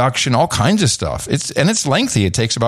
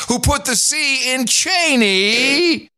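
mock announcer line; 12 semitones higher, much slower than usual. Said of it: "It would have been".

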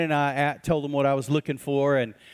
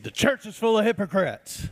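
mock grumpy man, higher and laughing; scoffing dismissal; 7 semitones higher than usual. Grumpy customer scoffing at pastor's church invitation, amused. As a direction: sneer it like a jaded customer. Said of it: "He said".

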